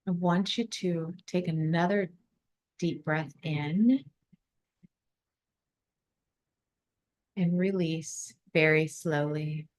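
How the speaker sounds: calm, measured guidance with long silences; guided meditation. Acted. Leads a breathing visualization.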